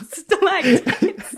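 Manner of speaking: Stalactites said slowly through a laugh. Shown as a demonstration. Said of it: "Which, which is right?".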